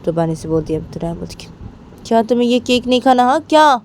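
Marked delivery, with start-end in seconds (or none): 2.1-3.8 high, loud bossy order; bossy demand. Acted. Rama ordering Bani to eat the cake, loud and bossy.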